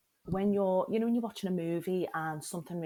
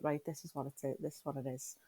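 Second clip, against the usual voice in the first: resigned inner voice; 3 semitones lower, 7 dB quieter than usual. Retold or imitated.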